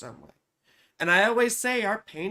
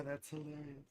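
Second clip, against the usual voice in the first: very soft, lower mutter; quiet aside; 4 semitones lower, 15 dB quieter than usual. Real, not acted. Host reacting to a chat comment, very softly. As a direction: say it under your breath. Reads chat, then repeats it louder.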